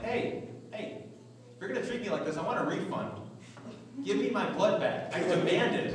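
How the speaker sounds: mock demand. Comic quoting himself at the nurse, mock indignant. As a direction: play-act demanding your blood back. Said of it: "I'm like".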